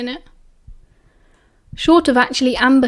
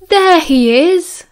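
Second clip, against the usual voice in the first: big, booming, slow greeting; 5 semitones higher, 5 dB louder, much slower than usual. A narrator's reading. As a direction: boom it across the table. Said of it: "James boomed".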